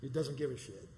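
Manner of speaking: ordinary talk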